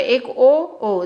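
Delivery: ओ, ओ held long and slow; teacher drawing out ओ slowly to show the sound; drawn-out vowel demo. Shown as a demonstration. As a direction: stretch each ओ out for the students. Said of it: "देखो कैसे".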